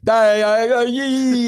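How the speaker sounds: put-on loud, high drunken yell. Drunk beachgoer yelling at lifeguard.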